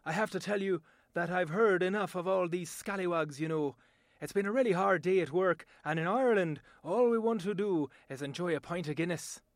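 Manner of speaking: tired Irish accent; an Irishman longing for a pint after work. Shown as a demonstration. An Irishman after a hard day, in an Irish accent. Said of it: "my voice talent demonstration".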